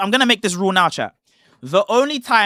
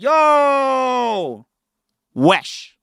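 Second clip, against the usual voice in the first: higher, punchy wesh at something crazy; amazed wesh; 5 semitones higher than usual. Shown as a demonstration. Explaining slang to cohost, demoing amazed yo wesh. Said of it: "if something crazy happened, you could be like".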